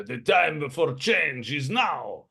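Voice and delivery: high, drawn-out rallying cry; noble yelling back. Acted. Noble answering a treason charge, high slow proclamation. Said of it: "you hear other people yelling back at her".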